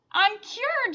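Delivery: put-on girl celebrating her cure, shouting; joyful shout. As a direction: shout it in delight. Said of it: "she shouted".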